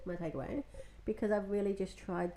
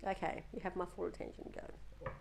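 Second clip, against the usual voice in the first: quiet patient prompt; 9 dB quieter than usual. Retold or imitated. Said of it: "And I'm like".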